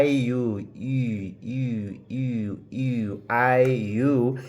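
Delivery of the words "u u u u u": "U" repeated softly as a drawn-out ee-oo glide; simplified French U. Shown as a demonstration. Teacher simplifying the French U for learners, repeated soft ee-oo glide. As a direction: say each "U" evenly, easing from ee into oo. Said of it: "tell you to say something like".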